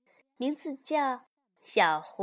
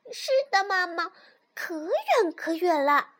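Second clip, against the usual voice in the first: high, eager little fox voice; 6 semitones higher, 4 dB louder than usual. A narrator's reading. Little fox answers mother about the world's end, high fox voice. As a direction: pitch it up like a small fox cub. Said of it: "小狐狸回答说".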